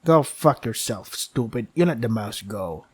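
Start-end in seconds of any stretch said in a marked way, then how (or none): 1.8-2.8 steady storyteller reading of the game text; game story narration. Acted. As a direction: narrate it like a dark fable.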